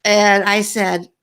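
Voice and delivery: ordinary talk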